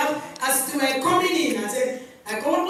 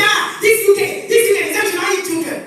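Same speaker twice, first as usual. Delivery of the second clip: louder, higher mocking voice; mocking rant; 8 semitones higher, 8 dB louder than usual. Mother mocking what UK authorities tell parents, loud and scornful. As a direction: send up the UK rules with scorn.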